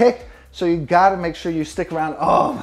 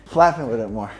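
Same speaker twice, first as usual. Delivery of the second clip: ordinary delivery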